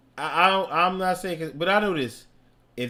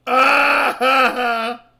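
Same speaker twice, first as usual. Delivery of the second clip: loud high cry of ha ha; high, loud mock ha ha; high mock shout-laugh; 11 semitones higher, 12 dB louder, much slower than usual. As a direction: yell it like a clueless guy. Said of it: "we're like".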